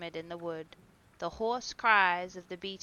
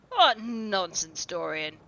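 laughing scoff; 7 dB louder, slower than usual. A narrator's reading. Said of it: "laughed his companion".

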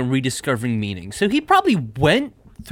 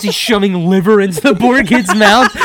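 ordinary delivery

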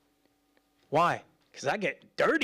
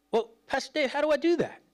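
higher, questioning voice of a listener; curious congregant; 9 semitones higher, faster than usual. Pretend. Congregant asking Pastor Dave, higher and puzzled. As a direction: play a puzzled churchgoer.

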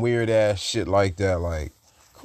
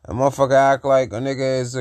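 usual speaking voice

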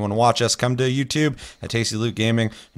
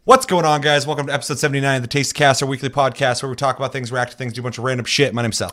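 upbeat, raised host voice; podcast host intro; 5 semitones higher, 4 dB louder than usual. Acted. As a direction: greet the audience like a show host.

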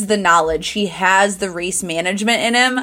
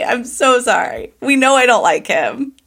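ordinary delivery

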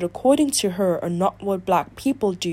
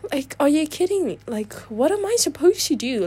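higher-pitched, incredulous venting; exasperated disbelief; 8 semitones higher than usual. Real, not acted. Ranting about being judged for acting.

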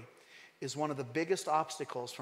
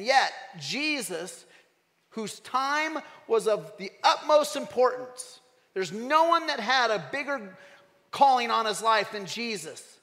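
preaching at full force; 7 semitones higher, 8 dB louder than usual. Real, not acted. Preacher builds to climax.

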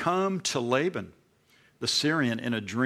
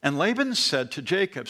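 ordinary talk, nothing marked

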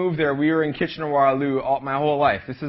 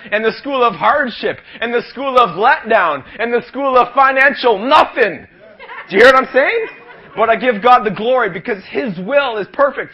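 raised, pumped-up revival sermon; preaching at full force; 6 semitones higher, 8 dB louder than usual. Acted. Youth preacher firing up the young crowd, full preaching voice.

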